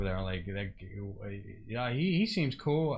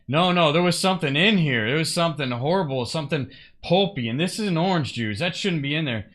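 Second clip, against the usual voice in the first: louder mock-indignant protest; mildly heated complaint, acted out; retold angry customer; 3 semitones higher, 8 dB louder than usual. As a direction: imitate an upset customer insisting something is wrong. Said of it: "he looked all angry".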